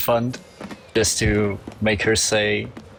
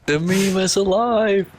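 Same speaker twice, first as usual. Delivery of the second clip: excited proclamation; 9 semitones higher than usual. Real, not acted.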